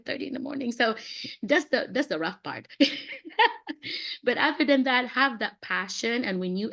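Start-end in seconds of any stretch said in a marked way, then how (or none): 2.7-4.2 bright high burst of laughter; real laugh. Real, not acted. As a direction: laugh at your own joke. Laugh after joke.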